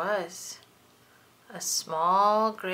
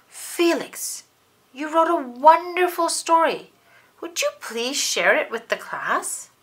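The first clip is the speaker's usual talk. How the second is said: higher, bright praise; praising teacher; 8 semitones higher, 5 dB louder than usual. Acted. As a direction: make him proud.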